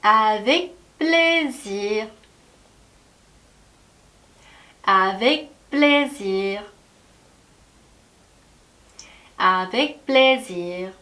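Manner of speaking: Avec plaisir very slowly, stress on plaisir; drawn-out Avec plaisir with gaps to echo; teacher models Avec plaisir; model for repeating. Shown as a demonstration. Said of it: "한번 따라해 볼게요".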